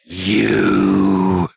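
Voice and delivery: low, loud menacing ogre growl. Acted. Ogre spotting the party in the corner, low loud menace. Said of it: "it looks over in your direction and goes".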